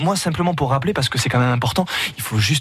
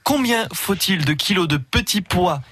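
booming prize announcer; 8 semitones higher than usual. Acted. Radio host announces the contest.

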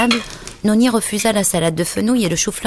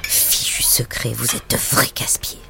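whispered, grumbling under her breath; annoyed muttered whisper; grumbled mutter; 4 semitones lower, 6 dB quieter than usual. A narrator's reading. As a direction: mutter it crossly to yourself while clearing up. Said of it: "en marmonnant".

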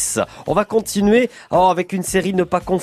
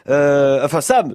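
usual speaking voice

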